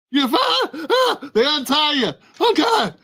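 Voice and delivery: high gasping cries of fright; startled victim. Acted. Freed victim crying out in fright, high. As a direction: yelp in sudden fear.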